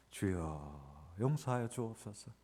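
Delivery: slow, hushed plea to God; quiet prayer. Retold or imitated. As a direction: pray softly, drawn out. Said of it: "그렇게 기도하면서". (아주 느리고 작게 드리는 기도)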